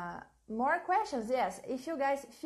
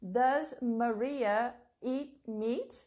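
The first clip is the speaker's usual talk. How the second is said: slow dictation; much slower than usual. Shown as a demonstration. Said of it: "Please write this question here for me".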